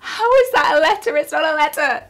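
high, loud exasperated protest; mock outrage. Real, not acted.

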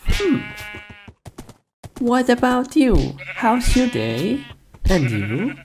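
high squeaky animal reply; squeaky sheep voice. Acted. Player voices a sheep answering in a high squeak.

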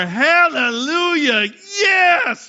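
loud, high cheering cry; cheerleader cheer. Shown as a demonstration.

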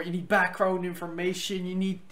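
hyped vlogger voice; lightly voiced hyped vlogger; hype voice. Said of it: "you talking in all hype voice like".

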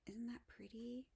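soft whispered question; admiring whisper. Real, not acted. Showing ordered lace to viewers, whispered admiration.

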